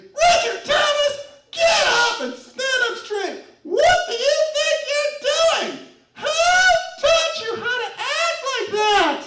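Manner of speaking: high, loud, slow stern mother voice; a mother scolding her grown son; shrill high parental rebuke, drawn out; scolding mom. Retold or imitated. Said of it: "my mom walks into the room, and she's like".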